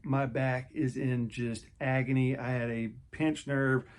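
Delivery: ordinary talk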